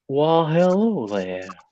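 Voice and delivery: a Southern lawyer greeting the crowd, slow and high; honeyed southern lawyer drawl. Acted. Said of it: "my Straz voice. ... like a Southern lawyer".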